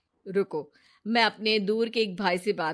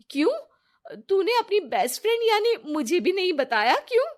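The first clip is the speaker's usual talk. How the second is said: rising, higher and higher disbelieving questions; surprised; 10 semitones higher than usual. Acted. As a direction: act shocked at being left out. Friend hears sudden resignation news.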